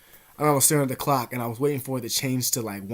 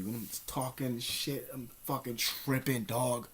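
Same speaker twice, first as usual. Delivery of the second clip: ordinary talk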